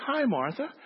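put-on high, bright small-boy greeting; little boy voice. Pastor's little son greeting the secretary, high child voice. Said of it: "heard this little voice again".

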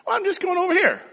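put-on high boy's voice. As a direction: sound like an innocent little boy.

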